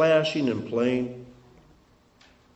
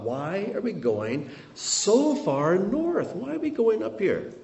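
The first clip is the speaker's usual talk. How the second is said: higher-pitched, drawn-out puzzled questioning; puzzled disciples; 8 semitones higher than usual. Acted. Disciples wondering about the long hike north, puzzled and questioning. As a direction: swoop up in mild mock bewilderment at the long trek. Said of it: "His disciples must have thought".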